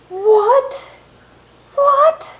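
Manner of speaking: disbelieving cry at the spoiler; shocked disbelief. Real, not acted. Spoiler outrage.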